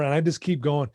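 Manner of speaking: usual speaking voice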